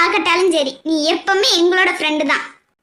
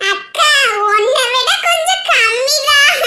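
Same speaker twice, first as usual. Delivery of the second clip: put-on high, drawn-out child voice with a giggle; cheeky little girl, teasing; 7 semitones higher, slower than usual. Little girl teases elder sister back, cheeky child voice.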